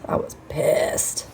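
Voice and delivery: slow whispered admission. Real, not acted. Admitting to viewers how angry she was, slow whisper. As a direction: whisper it slowly, letting anger show.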